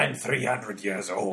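low, harsh ancient voice; gruff old man. Acted. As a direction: rasp it like a very old sea captain. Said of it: "the whole vocal affect is really a glottalization".

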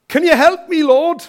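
loud, high-pitched plea; desperate shout. Acted. Man on branch begs God, high acted shout. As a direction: shout up to the sky for help. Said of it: "he shouts out again".

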